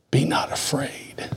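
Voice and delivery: slow, hushed whisper; slow comforting whisper. Acted. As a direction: breathe it out gently and slowly. Reading verse softly.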